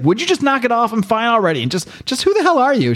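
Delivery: fed up, make her back off; irritated retelling of Kevin's outburst; exasperated. Retold or imitated. Kevin snaps at the pestering girl.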